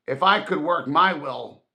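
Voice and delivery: full of outrage; indignant. Acted. Scrooge vowing revenge on Christmas fools, indignant. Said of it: "said Scrooge indignantly".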